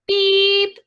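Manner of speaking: high, loud toy horn beep; one held, high-pitched beep. A narrator's reading. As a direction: beep like a cheerful little truck horn. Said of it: "Blue said".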